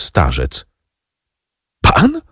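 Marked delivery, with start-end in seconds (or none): 1.8-2.2 high, stunned cry; the truth hits him like a blow; shocked cry. A narrator's reading. Franz learns Noirtier killed his father, shocked cry. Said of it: "zawołał Franz".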